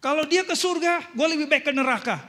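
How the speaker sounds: high, loud mock defiance; spiteful outburst. Voicing a spiteful thought.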